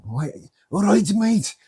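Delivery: high, slow, badly faked Glaswegian; Glaswegian accent. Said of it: "He had a real Glaswegian".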